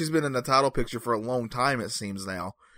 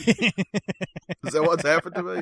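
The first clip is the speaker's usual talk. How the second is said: higher, slow, laughing question; laughing disbelief; 6 semitones higher, slower than usual. Real, not acted. Podcaster joking he's attracted to ogres, laughing disbelief. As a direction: draw out the mock realisation through a laugh. Mock realisation he likes ogres.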